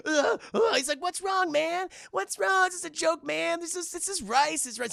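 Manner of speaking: high, loud fake concern; hammed-up sneering what's wrong; vampire mocking Michael's panic; mock innocent teasing.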